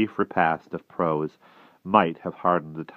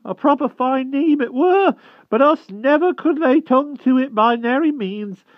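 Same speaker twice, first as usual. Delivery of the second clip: humble hedgehog voice; 8 dB louder than usual. Acted. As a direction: squeak it out humbly like a shy hedgehog. Hedgehog keeps speaking.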